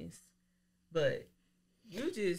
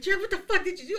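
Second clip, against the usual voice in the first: alarmed callers; 11 semitones higher, 4 dB louder, much faster than usual. Retold or imitated. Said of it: "people calling me like".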